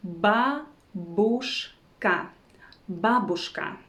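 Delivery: babushka in three separated pieces, ba, bush, ka; syllable by syllable. Shown as a demonstration. Teacher teaching a Russian word, syllable by syllable. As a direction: break babushka up with clear pauses.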